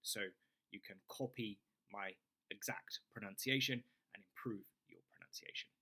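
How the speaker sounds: usual speaking voice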